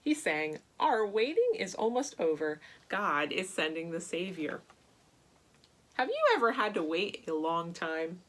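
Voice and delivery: plain reading voice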